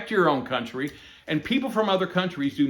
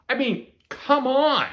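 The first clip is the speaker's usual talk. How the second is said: high, slow, exasperated come on; 9 semitones higher, 3 dB louder, slower than usual. Real, not acted. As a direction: drag it out in disbelief. Exasperated rant about immigration groups.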